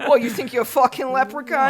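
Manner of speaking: loud high mocking Boston jeer; Boston bully. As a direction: taunt like an angry Southie local. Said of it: "what they would call you".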